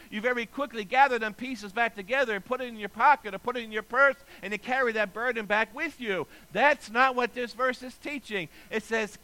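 usual speaking voice

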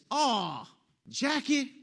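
loud, high mock wail to his wife; imitated shout. Husband suddenly calling to his wife while driving, imitated shout. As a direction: cry out in exaggerated dismay. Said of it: "Gary shouts out".

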